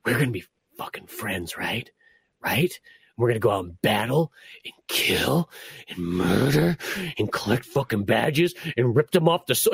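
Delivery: low, hushed, menacing voice dropping to a whisper; creepy rival girl. Retold or imitated. Mocking the clingy rival girl in a low creepy whisper. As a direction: murmur it like an unhinged new best friend.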